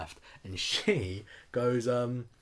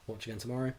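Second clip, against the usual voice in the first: usual speaking voice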